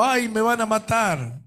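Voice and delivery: high, slow mock lament of martyrs; a hammed-up wail of fear. Preacher mocking a fearful martyr's wail. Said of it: "no estaban llorando".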